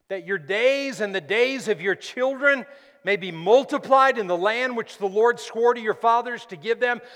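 loud, high-pitched preaching climax; preaching at full force. Acted. Preacher warning the church about the enemy, shouting. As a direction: build it into a full-throated warning.

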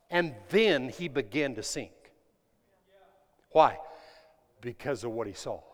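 usual speaking voice

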